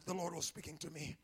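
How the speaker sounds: gentle reassurance. Retold or imitated. Quoting comfort to caller, softly.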